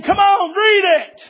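high, urgent holler; insistent scream. Retold or imitated. Preacher who kept urging the boy to read, high insistent shout. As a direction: keep hollering the command, voice way up. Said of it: "I can scream at you".

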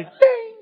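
very high, ringing ding; imitated email ding. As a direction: chime like a mail alert, high and sustained.

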